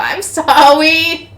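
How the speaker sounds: slow, high-pitched plea; grovelling apology. Acted. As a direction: draw out a hammed-up apology. Jumping kowtow apology.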